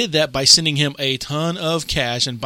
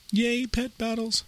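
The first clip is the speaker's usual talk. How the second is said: sarcastic yay; 9 semitones higher, 6 dB quieter, slower than usual. Real, not acted. Mock cheer about pet battles.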